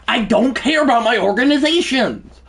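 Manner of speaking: high, loud outburst; high-pitched angry protest; exasperated shout. Real, not acted. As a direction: shout it at the game, fed up. Ranting at repeated game pop-ups.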